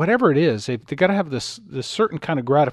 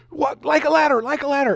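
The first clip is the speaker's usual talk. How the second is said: anxious urging; 10 semitones higher than usual. Retold or imitated. Urging son how to climb.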